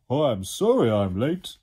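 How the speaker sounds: big, slow, loud creature voice; Slime calling out. Acted. Slime arrives late down the chimney, calling out. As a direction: call out an apology, loud and stretched. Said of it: "Slime called out".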